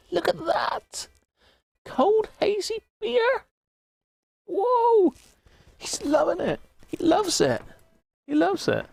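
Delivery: very high, excited cartoon penguin squeak; squeaky penguin voice. The toy penguin cheering the hazy beer in a squeaky voice.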